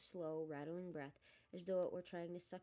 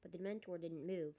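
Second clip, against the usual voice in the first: ordinary delivery, nothing marked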